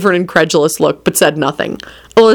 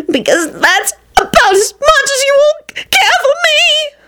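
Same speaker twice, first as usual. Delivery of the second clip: very high, slow tearful wail; howling; slower than usual. A narrator's reading. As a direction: drag out a self-pitying howl. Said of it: "Loretta howled from the other end".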